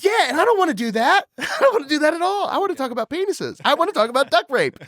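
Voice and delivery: high-pitched protesting rant with laughter; comic exasperation. Real, not acted. Comic complaint about audio work.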